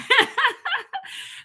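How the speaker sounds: a high, breaking laugh holding back tears; teary laugh. Real, not acted. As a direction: laugh through tears you're fighting. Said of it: "and I'm not gonna cry".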